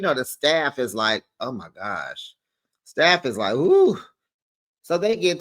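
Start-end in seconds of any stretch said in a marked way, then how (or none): 1.4-2.3 low, hushed mock dismay; weary aside. Staff reacting to another table argument, hushed dismay. 3.5-4.0 high swooping "ooh"; staff "ooh". Retold or imitated. Staff watching guests argue, high swooping ooh. Staff reacting to endless arguing.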